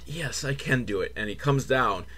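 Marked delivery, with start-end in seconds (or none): 0.0-1.1 low, soft self-assurance; timid hero's reply. Acted. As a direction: answer softly and low, convincing yourself. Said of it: "He's like".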